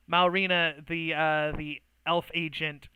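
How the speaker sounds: usual speaking voice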